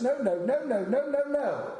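put-on high, rising string of childish no's; stubborn refusal. Pastor refusing his friends, stubborn childish no's. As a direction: dig in like a sulky kid refusing.

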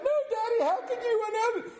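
put-on high hurt child's cry; betrayed child. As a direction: sound like a child who feels betrayed. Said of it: "And the kid's like".

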